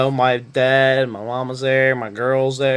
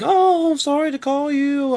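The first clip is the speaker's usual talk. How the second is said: much higher, squeaky mock interviewer voice; mimicking the interviewer; 13 semitones higher, slower than usual. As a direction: play the apologetic recruiter in a falsetto. Mimicking apologetic interviewer on phone.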